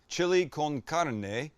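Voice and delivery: "con carne" as "kohn KAR-neh"; careful round "oh" in "con", tapped r in "carne"; corrected Spanish pronunciation. Real, not acted. Host recapping after being corrected, careful Spanish pronunciation.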